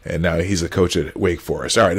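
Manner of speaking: loud, low-pitched wrap-up; suddenly real loud. Real, not acted. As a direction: push the volume right up, close on the mic. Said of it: "you just got real loud for a second".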